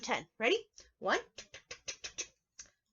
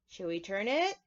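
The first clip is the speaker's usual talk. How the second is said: usual speaking voice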